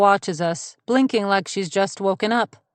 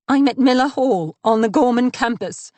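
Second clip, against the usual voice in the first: higher, louder, breathless panic on the phone; panicked; 5 semitones higher, 4 dB louder than usual. Acted. Mina fakes panic to the 911 operator, gasping and frightened. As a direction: fake a terrified emergency call. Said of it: "She sounds genuinely panicked".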